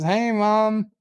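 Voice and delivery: put-on playful kid-to-mother greeting; chirpy greeting. [name] greets his mother in skit.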